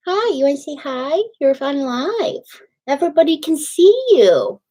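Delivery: high, cooing voice for the puppy; sing-song pet talk. Real, not acted. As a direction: coo at the puppy like a doting dog mom. Showing the puppy to chat.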